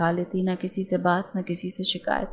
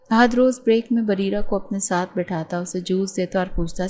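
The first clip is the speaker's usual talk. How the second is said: ordinary delivery, nothing marked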